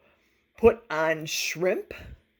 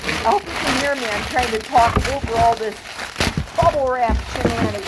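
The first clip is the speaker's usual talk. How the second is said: raised voice over rustling wrap, fast; speaking up fast over packing noise; talking over bubble wrap; 3 semitones higher, 4 dB louder, much faster than usual. Real, not acted. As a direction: push the voice over the crinkling noise. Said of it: "I'm kind of yelling".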